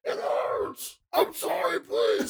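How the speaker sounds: high pained pleading whimper; tortured soul voice. Acted. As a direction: beg like a suffering ghost. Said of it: "You can hear one of them now".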